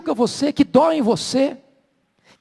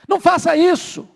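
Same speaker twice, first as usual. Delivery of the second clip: put-on angry boy; 7 semitones higher, 4 dB louder than usual. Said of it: "Aí o menino ficou bravo".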